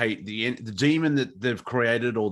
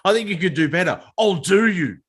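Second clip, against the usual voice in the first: higher, louder offended retort; mock indignant Karen; 6 semitones higher, 6 dB louder than usual. Karen snapping back at a helpful stranger, higher and louder. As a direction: play the prickly Karen taking offence. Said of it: "unless you're sacrificing yourself".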